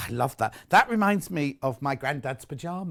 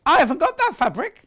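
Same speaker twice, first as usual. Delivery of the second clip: surprised; 11 semitones higher, 5 dB louder than usual. Real, not acted. Surprised at missing fabric.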